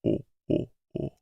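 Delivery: lightly voiced Jabba chuckle; low mock Hutt "oh, oh"; Jabba laugh. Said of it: "Just him going".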